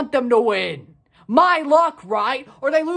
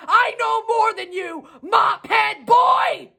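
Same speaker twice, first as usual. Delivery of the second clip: mocking taunt; 5 semitones higher, slower than usual. Real, not acted. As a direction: spit out the insult slowly. Taunting the owner.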